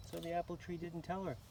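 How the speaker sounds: plain reading voice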